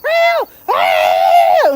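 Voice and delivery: loud yowling mock meows; imitating cat. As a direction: yowl like a cat at the door. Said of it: "he'll go to the door".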